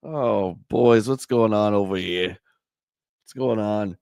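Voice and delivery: ordinary delivery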